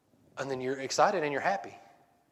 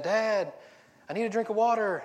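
put-on raised, higher kid's call down the hall; 7 semitones higher than usual. Kid calls to dad down the hall, raised plea.

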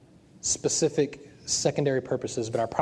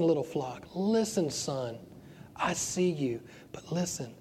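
God speaking tenderly; 5 dB quieter, slower than usual. Retold or imitated.

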